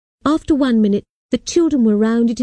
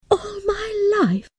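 much higher, slow, crying disbelief; a girl stunned and near tears; high tearful shock; 12 semitones higher, 5 dB quieter, slower than usual. Acted. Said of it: "I couldn't believe it".

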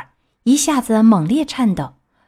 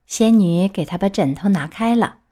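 ordinary delivery

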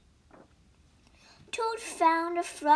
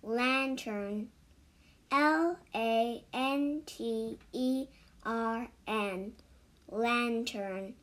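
slow letter-by-letter spelling; 2 semitones lower, much slower than usual. Shown as a demonstration. Teaching children the word cellar, slow spelling. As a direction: spell each letter slowly for learners. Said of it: "第二个单词lantern".

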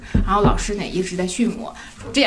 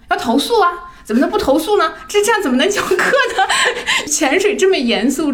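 indignant urging; 7 semitones higher than usual. Retold or imitated. Partner to her about the coach, laughing mock outrage. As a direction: egg her on to complain, half laughing.

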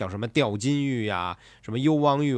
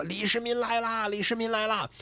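eerie ghost cry; 10 semitones higher, 4 dB quieter than usual. Acted. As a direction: call out like a crowd of haunting ghosts. Ghosts in city shout his name.

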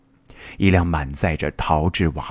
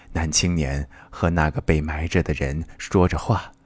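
plain reading voice

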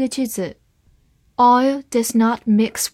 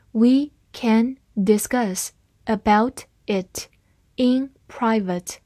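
slow, word by word; slow reading; slower than usual. Shown as a demonstration. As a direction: read it slowly and clearly for learners. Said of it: "好，慢慢来读。".

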